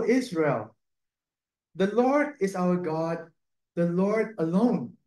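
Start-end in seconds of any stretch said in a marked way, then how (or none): none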